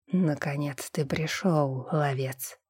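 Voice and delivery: low, slow and muffled; a dull voice from deep inside; hollow voice. Acted. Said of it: "Глухой голос".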